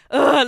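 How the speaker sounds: loud groan of exasperation; disgusted groan. Real, not acted. Woman recalling a traumatizing exam, loud exasperated groan. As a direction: let out a big, loud "ugh".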